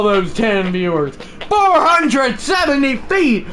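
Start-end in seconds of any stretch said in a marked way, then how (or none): 1.1-3.5 excited shout. Real, not acted. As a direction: belt out the distance in awe. Calling out a homer's distance.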